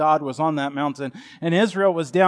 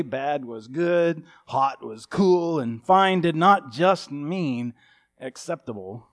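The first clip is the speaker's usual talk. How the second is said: normal talking voice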